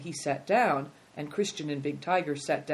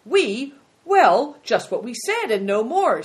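loud, high, startled answer; taken aback; 8 semitones higher, 10 dB louder than usual. A narrator's reading. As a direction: blurt it high and loud, caught off guard.

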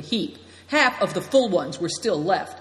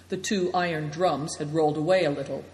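plain reading voice

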